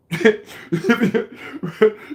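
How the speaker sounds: high burst of laughter. Real, not acted. Laughing hard at his own Chris Rock joke.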